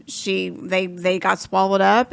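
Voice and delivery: ordinary delivery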